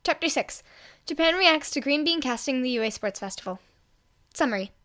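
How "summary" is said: ordinary delivery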